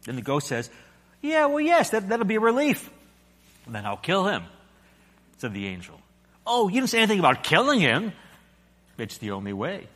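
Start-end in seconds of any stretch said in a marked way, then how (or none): 1.2-2.9 high, flustered ghost voice; the anxious ghost, voice jumping up; nervous ghost. A narrator's reading. Ghost answers the angel. 3.7-4.4 calm angel. A narrator's reading. Angel speaks. 6.5-8.1 fast alarmed ghost. A narrator's reading. Ghost protests. 9.0-9.9 low, soft, steady angel voice; a quiet, unhurried angel; calm angel. A narrator's reading. Angel speaks.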